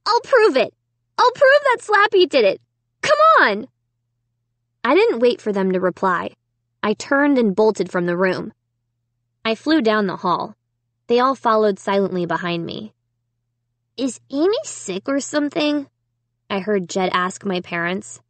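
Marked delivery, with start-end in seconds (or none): 0.1-3.7 high, loud, frantic urging; cried out. A narrator's reading. Girl promising proof to parents, crying out urgently. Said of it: "I cried". 14.0-15.9 put-on higher, puzzled boy; young brother's question. A narrator's reading. Brother asking parents about sister, puzzled higher voice.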